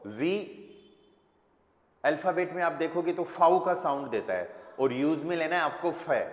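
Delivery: usual speaking voice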